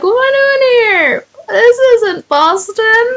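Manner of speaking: very high, whimpering crying voice; high, teary scared cartoon voice; scared little koala. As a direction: whimper like a frightened cartoon critter. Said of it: "he's so scared 'cause he's like".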